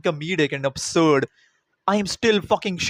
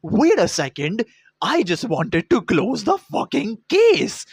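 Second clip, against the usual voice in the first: slow, louder mock cop; mock sudden turn; 4 semitones higher, 3 dB louder, slower than usual. Cop covering himself to Jaya's husband, slow mock. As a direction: hold up, stretch it out in mock innocence. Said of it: "then he's like".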